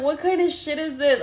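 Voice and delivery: high, laughing through the words; half-laughing mock panic; laughing disbelief. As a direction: giggle out your own panic. Retelling bad trip panic.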